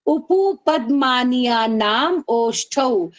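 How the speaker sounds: slow, drawn-out sutra chant; slow recitation. Shown as a demonstration. Teacher chants the sutra slowly for the class. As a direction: stretch each syllable. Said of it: "अब देखो".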